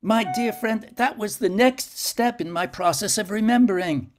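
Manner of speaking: no no, you've got it backwards, eagerly; insisting with lifted urgency; eager protest. Acted. Eager protest to his friend.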